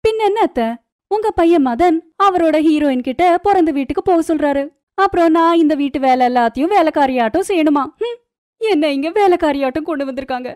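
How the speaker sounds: high-pitched angry complaint; indignant yelling. A narrator's reading. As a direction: protest to the mother-in-law at a high pitch. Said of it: "ஏன் இப்படி கத்துற?". (ஆவேசமாக உயர்ந்த குரலில் கத்தல்)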